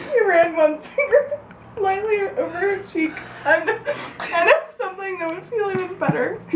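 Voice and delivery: high, giggly reading voice; laughing while reading. Real, not acted. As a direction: fight the giggles line by line. Reading erotic passage laughing.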